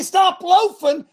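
high, loud, slow shout; coach yell. Retold or imitated. Coach yelling at the team, slow loud shout. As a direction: drag it out at the team. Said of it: "I say".

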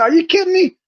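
re-live the shock of the coincidence; amazed disbelief. Retold or imitated. Retelling meeting a Marshfield stranger, amazed. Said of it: "I said".

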